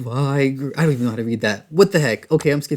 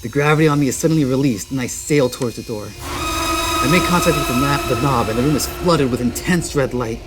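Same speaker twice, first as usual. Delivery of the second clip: dramatic horror narration fading to a hush at the end; story narration; 4 semitones lower than usual. A narrator's reading. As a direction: build the escape, then let it sink into dread. Reads game story text aloud.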